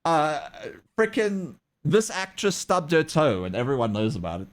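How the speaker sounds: ordinary talk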